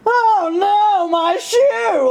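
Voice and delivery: over-the-top mock distress; mock dramatic dismay. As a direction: play the drama queen over a shoe.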